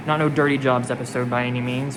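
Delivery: ordinary delivery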